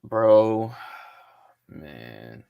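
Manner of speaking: usual speaking voice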